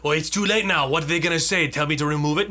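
put-on accent, vaguely German at points; muddled mock accent. Imagined director shrugging off a late complaint, muddled vaguely German accent. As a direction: attempt a director's voice in a wobbly German-ish accent. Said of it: "I don't know what accent that was".

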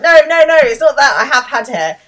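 loud, high, insistent denial; urgent protest. Retold or imitated. Will protesting to Daisy he has had hair, urgent. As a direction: burst in to correct her, loud and high.

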